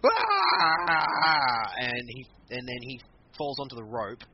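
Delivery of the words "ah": high, hammed-up yell of pain; imitating a wrestler's scream; mock pained cry. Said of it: "caused Lesnar to go".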